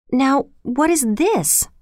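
storybook bewilderment; confused. A narrator's reading. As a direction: ask it slowly, baffled.

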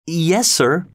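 high, timid reply; small frightened yes; scared boy. Acted. Pip answering the convict, high and scared.

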